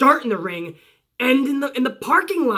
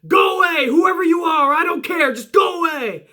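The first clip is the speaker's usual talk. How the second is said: retold yell; 6 semitones higher than usual. Corbin yelling at Elias through the door, higher angry shout. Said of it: "yells out from inside the office".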